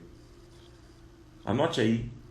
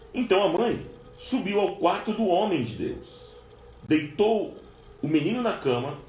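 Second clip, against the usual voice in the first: fervent preaching; 5 semitones higher than usual. Acted. Preacher retelling the Shunammite's journey to the congregation, fervent. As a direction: drive the story home with fervour. Preaching the story at full force.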